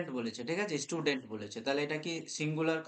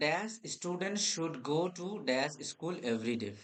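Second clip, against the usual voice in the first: slow dictation; much slower than usual. Shown as a demonstration. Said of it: "চার নম্বর প্রশ্ন".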